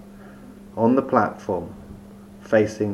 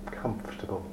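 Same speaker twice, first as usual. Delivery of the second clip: whispering; 2 semitones lower, 18 dB quieter, faster than usual. Acted. Relaxation guide drops to whisper.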